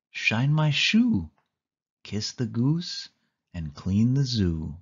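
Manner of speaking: plain reading voice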